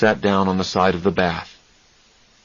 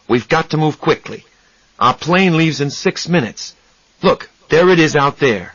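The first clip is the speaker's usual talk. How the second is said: urgent; 6 semitones higher than usual. Acted. Charlie hurrying Raymond.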